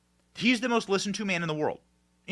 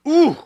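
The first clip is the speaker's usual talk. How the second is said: high, loud exclaimed ooh of outrage; outraged ooh; 12 semitones higher, 9 dB louder than usual. Real, not acted. Host reacting to the debate, outraged ooh. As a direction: let out a sharp, indignant ooh. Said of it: "enough to get your blood boiling".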